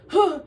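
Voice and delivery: loud, high mock sigh of a tired guy; imitated sigh. Nick resting head on her shoulder, mock weary sigh. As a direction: heave a big put-on sigh. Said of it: "and went".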